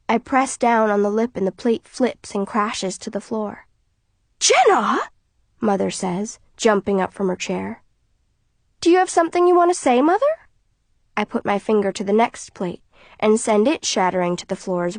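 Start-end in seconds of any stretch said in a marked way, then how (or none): 4.4-5.0 higher, sharp cry of a name; shocked mother calling out; mother jolted by the crash; startled cry. Acted. Plate crashes, mother exclaims. 8.8-10.3 fast, higher, taunting question; defiant challenge. Acted. Daughter defiantly challenging mother.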